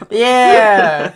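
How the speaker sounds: high laughing yeah; host cracking up at the guest, laughing high. Real, not acted.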